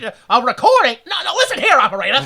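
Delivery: loud high-pitched huffy complaint; indignant fifties man. Acted. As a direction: scold the operator like an outraged old-timer. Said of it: "he's like".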